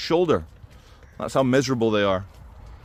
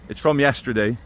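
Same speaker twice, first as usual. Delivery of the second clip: usual speaking voice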